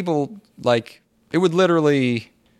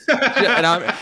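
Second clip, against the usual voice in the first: usual speaking voice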